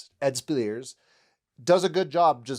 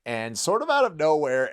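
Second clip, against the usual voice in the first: usual speaking voice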